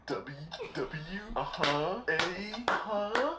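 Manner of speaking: high wailing sob, then small choked letters; mock sobbing. Kid sobbing out letters for his mom. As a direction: blubber out each letter like a crushed kid.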